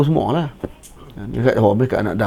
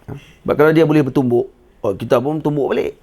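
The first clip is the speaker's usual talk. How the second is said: ordinary talk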